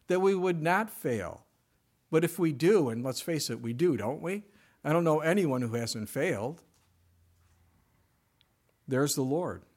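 normal talking voice

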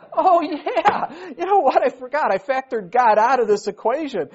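the penny drops, say it out loud; sudden realization. Real, not acted. Sudden realization about God.

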